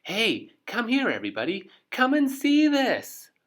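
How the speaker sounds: high, loud calling shout. Acted. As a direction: call the whole crowd at the top of her voice. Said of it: "shouted at the top of her voice".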